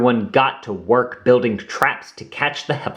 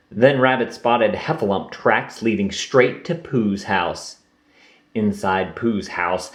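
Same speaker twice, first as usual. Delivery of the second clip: ordinary delivery, nothing marked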